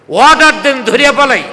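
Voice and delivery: loud, high urging; urgent henchman. Retold or imitated. Abu Jahl's henchmen asking their boss for orders, loud urging.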